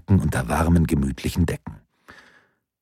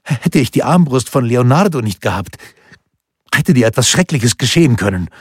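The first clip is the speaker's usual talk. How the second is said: higher, louder, urgent pleading; feverish urgency; 6 semitones higher, 5 dB louder than usual. A narrator's reading. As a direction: press every word with urgency. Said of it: "Er sprach mit fiebriger Dringlichkeit".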